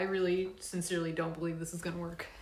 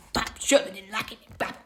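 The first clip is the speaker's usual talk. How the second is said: "bop" as a little mouth pop, loud and jokey; playful sound effect; 5 dB louder than usual. Real, not acted. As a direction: punctuate the move with a cheeky "bop". Pushing the camera into place.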